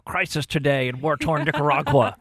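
lightly imitated cheek-chewing anchor; a hint of starchy news reader; stern newscaster voice. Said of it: "chew on their cheeks to try to sound".

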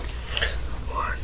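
counting in a bare whisper. Real, not acted. Counting items under breath.

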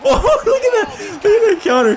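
high, loud laughing excitement; real excited crowing; thrilled outburst. Real, not acted. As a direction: exclaim high through a laugh at a great move. Thrilled by a counter kick.

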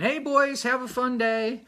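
high, bright mock wife's farewell; lightly voiced wife calling from the car; voicing Roseanne. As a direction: sing it out high like his wife waving goodbye. Wife dropping Chad off in dream.

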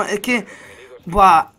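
usual speaking voice

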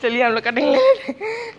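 giggling while trying to carry on; fighting back laughter, pitch up; laughing through words. Real, not acted. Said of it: "बहुत हंसी आ रही है". (हंसी रोकते हुए बोलना)

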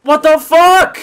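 loud, high, incredulous outburst; shocked shout. Real, not acted. Streamer shocked by the game, shocked shout. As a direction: shout it in total disbelief.

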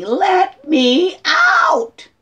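high, loud cry to be let out; screaming inner child. Acted. Trapped inner child begging the grown-up self, screaming. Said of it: "This little being screaming".

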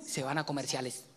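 usual speaking voice

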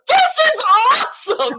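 loud, high excited shout. Retold or imitated. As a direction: shout it like a thrilled warrior. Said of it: "Cadian goes".

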